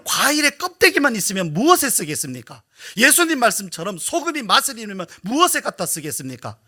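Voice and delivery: full-force sermon delivery; fervent preaching. Acted. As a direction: preach it out to the congregation. Rising preaching passage.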